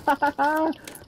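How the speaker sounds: high burst of laughter; excited laugh. Real, not acted. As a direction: laugh out the win. Laugh after kill.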